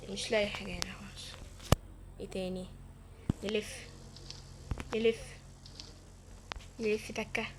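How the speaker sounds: whispered, disappointed muttering; hushed searching whisper. Real, not acted. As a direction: whisper it to yourself while searching. Searching quietly in game.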